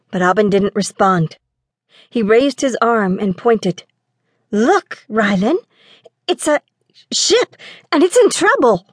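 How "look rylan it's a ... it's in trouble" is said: climbing much higher as the ship comes into view; excited alarm. Acted. Aubin calls to his brother about the ship, in excited alarm. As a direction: point and shout it out, rising alarm.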